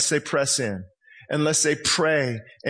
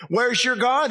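higher, rising taunt; mocking jeer; 6 semitones higher than usual. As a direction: sneer the question up high. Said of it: "Mocking the prophets".

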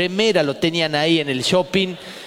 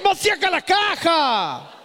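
mock grinding yell; 10 semitones higher than usual. Gears grind in old truck.

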